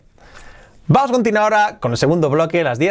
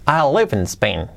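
fluent, lifting "live"; natural English melody; with intonation. Shown as a demonstration. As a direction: link the words and lean on "live". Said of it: "Repetido con entonación".

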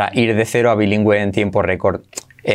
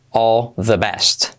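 very slow, each word laid out; slow explained; 2 semitones lower, much slower than usual. Shown as a demonstration. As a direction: spell it out slowly for learners. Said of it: "primero explicado lento". (despacio, palabra por palabra)

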